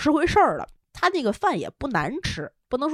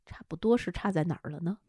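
whisper; 4 semitones lower, 10 dB quieter than usual. Acted. Teasing interview question.

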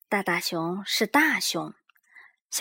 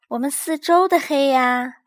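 loud, high cub voice; insistent little cub; Little Bear voice; 6 semitones higher, 9 dB louder than usual. A narrator's reading. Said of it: "cub insists".

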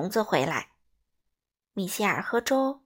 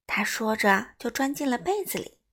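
ordinary delivery

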